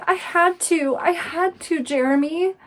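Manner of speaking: acted frightened plea, pitched high; scared, defensive. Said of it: "she's clearly defensive. Like, she's scared".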